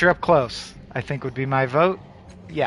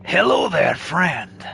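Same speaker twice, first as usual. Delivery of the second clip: trial character voice; much slower than usual. Acted. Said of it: "What fucking voice have I not used?".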